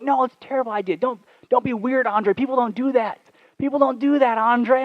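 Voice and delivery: high-pitched imitation of exasperated girlfriend; mock protest. Girlfriend telling boyfriend not to be weird, mock exasperation.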